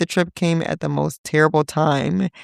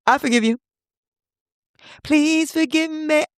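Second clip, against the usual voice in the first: dramatic pleading; 10 semitones higher than usual. Acted. Mock dramatic forgiveness over food.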